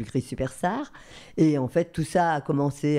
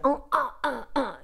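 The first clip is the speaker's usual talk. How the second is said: high, short karate yelps; high mock fighting cries without full volume; imitated karate cries; 11 semitones higher than usual. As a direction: mimic girls' karate cries, pitched way up. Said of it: "je vois les filles faire".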